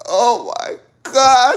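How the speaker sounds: high, slow stretched gasp of disbelief; drawn-out shocked cry. Retold or imitated. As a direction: stretch it out in horror. Retold shock at partner.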